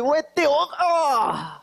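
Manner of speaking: high, excited blurt of "E T, ó"; blurted exclamation. Retold or imitated. (exclamação empolgada e aguda)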